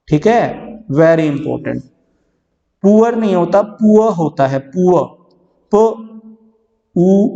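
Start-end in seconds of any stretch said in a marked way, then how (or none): 5.7-7.3 "प", "उ" said one by one with long gaps; sounds spelled out slowly. Shown as a demonstration. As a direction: break the word into its sounds. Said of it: "आर नहीं आएगा". ("प", "उ" अलग अलग धीरे से)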